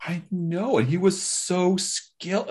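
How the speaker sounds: high-pitched duchess lamenting her perfumer; lightly voiced, drawn-out high lament.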